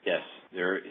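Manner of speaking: ordinary delivery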